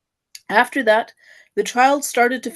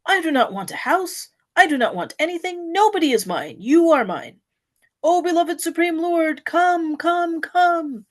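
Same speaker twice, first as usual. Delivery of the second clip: higher, fervent mild shout of surrender; devotee's desperate call to Krishna, raised; pleading shout; 7 semitones higher than usual. A narrator's reading. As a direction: give up everything and call him. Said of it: "Ultimately, he shouts".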